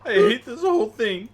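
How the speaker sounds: laughing exasperation. Real, not acted. Player laughing at the villain's taunt. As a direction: groan it through laughter.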